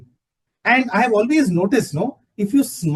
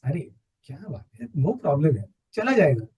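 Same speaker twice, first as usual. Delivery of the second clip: breezy, quick and much softer, brushing the anger off; relaxed, smiling dismissal; 5 semitones lower, 5 dB quieter, much faster than usual. Retold or imitated. Calming himself over anger, a quick, soft and breezy shrug-off. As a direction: toss it away lightly with a smile in the voice. All of a sudden a smile comes on your face.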